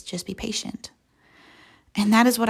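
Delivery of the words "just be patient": soft, hushed answer; quiet reassurance. Acted. God answers those tired of waiting, quiet reassurance. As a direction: drop right down to a gentle hush. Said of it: "he quietly turns to you".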